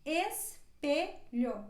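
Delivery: espelho drawn out slowly, closed e at the start; slow model word. Shown as a demonstration. Teacher slows espelho down for students. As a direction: stretch espelho so the e is heard. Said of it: "nós pronunciamos assim".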